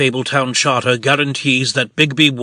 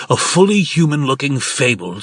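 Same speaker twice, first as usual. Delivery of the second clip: ordinary talk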